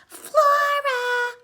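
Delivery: very high loud yell; full shout up the stairs; mother shouting. Acted. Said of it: "her mother shouted".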